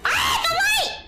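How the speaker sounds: sudden high, loud yelp; startled cry. Real, not acted. Gamer yelps when a bright light flashes.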